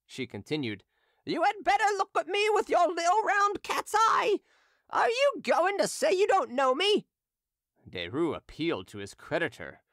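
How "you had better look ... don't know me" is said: put-on very high, loud taunting old hawker; drunken hawker. Drunken hawker taunting Derues before the crowd, high mocking voice. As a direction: taunt him gleefully like a drunk street seller.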